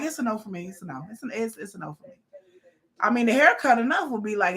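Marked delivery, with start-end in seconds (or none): 0.0-2.1 dismissive refusal. Retold or imitated. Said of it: "I would have been like".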